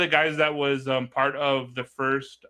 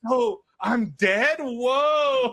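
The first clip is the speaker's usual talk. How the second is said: slow, high, dazed disbelief; drawn out like the news just landed; stunned surprise; 9 semitones higher, 4 dB louder, much slower than usual. Retold or imitated. Friend learns he was thought dead.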